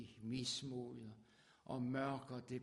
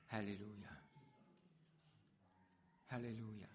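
ordinary talk

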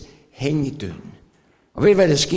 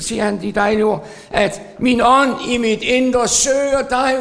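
higher and louder fervent exhortation; preaching at full force; 7 semitones higher, 3 dB louder than usual. Acted. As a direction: lift the congregation's eyes upward. Exhortation rising to halleluja.

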